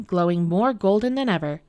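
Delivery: usual speaking voice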